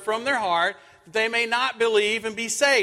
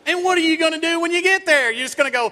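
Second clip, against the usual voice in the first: higher, louder mock wife voice; wife's scolding question; 9 semitones higher, 4 dB louder, faster than usual. Wife scolding the speeding husband, higher, louder mock voice. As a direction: ham up the wife's rebuke. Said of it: "She said".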